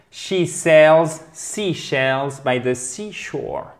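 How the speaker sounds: slow, deliberate tongue-twister reading; "She sells seashells by the seashore" taken very slowly; practice demonstration. Shown as a demonstration. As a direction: stretch out each word for learners to follow. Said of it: "Ok, let me try it out".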